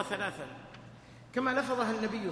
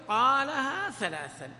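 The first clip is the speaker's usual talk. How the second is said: قالها ثلاثا said slowly, weight on قالها and a falling end; slow emphatic phrase; 4 semitones higher, 4 dB louder, much slower than usual. Shown as a demonstration. Teacher offering students the other wording, slow and deliberate. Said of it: "فإن أبيت فقل".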